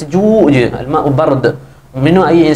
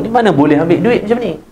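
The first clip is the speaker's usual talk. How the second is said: usual speaking voice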